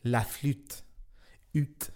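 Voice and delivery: la flute said the French way, then the ut drawn out alone; soft French demo of la flute; French la flûte. Shown as a demonstration. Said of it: "It's with the little hat on the U".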